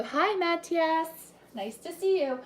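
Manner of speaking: a bright, stretched welcome; cheery greeting. Real, not acted. Host greeting a viewer mid-recipe, high and cheery.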